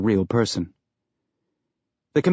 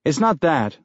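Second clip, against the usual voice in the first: higher, lightly voiced weedy man; weedy manager, placating; 5 semitones higher than usual. A narrator's reading. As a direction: let him down in a higher voice.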